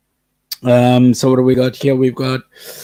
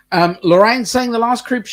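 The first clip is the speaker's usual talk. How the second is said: ordinary talk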